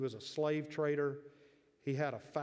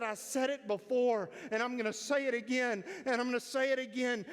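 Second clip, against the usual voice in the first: defiant declaration; 8 semitones higher than usual. Retold or imitated. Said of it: "and he said".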